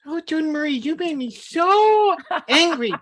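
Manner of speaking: very high, loud cartoonish voice; mock anger in a funny high voice; silly voice for anger. Shown as a demonstration. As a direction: say angry words in a goofy squeaky voice. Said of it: "changing your tone of voice".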